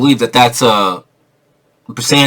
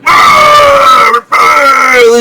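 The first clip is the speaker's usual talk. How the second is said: high, loud, slow mock monster yell; hammed-up zombie shrieks; 12 semitones higher, 6 dB louder than usual. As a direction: drag out a cartoon ghoul roar. Imitating monster men.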